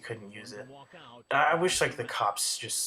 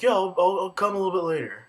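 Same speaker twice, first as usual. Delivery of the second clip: higher, much louder, fast mock-dad answer; hammed-up distracted dad answering; mock dad reply; 7 semitones higher, 6 dB louder, faster than usual. Mimicking the dad's reply.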